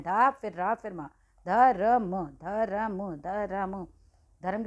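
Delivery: धर्म broken into ध, र, म, then blended slowly; slow syllable-by-syllable demo. Shown as a demonstration. Teacher slowly sounds out धर्म for young students. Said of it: "तो बोलते ऐसे हैं".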